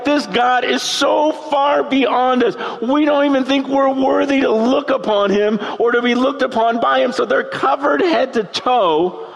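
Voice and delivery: normal talking voice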